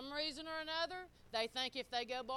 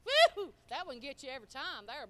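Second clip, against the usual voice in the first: ordinary talk, nothing marked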